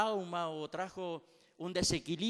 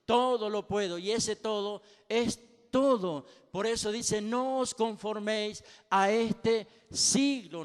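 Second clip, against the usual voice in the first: rising sermon, louder and louder toward a cry; fiery preaching; 2 semitones higher, 7 dB louder than usual. Acted. Pastor exhorting the congregation to seek God, building to full-voiced preaching. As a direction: preach it with growing fire.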